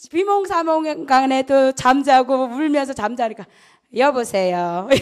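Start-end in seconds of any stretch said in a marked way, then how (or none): 3.9-4.9 lower, laughing through a sleepy hello; mock a half-asleep answer; groggy hello. Private answers the officer's call half-asleep, laughing.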